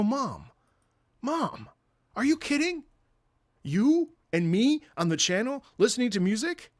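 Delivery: high, loud appeal to mom; acted-out exasperated coaxing; incredulous pleading. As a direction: beg mom as if she can't be serious.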